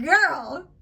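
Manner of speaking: exasperated sass; sassy exasperation. Retold or imitated. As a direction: give her a dry warning look in the voice. Reacting to the groin warning.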